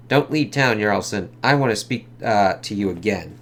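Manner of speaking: a hint of a tough detective; reading comic dialogue. Reads lawyer and cops.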